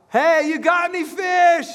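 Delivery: high, slow shouted hail across water; calling out from shore. Acted. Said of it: "And Jesus yells out".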